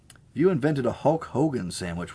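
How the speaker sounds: normal talking voice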